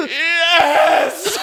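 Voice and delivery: high, wailing mock yell; shrieked, over-the-top admission; overemotional confession. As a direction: blurt it out as a hammed-up tearful confession. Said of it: "he's so emotional, he goes".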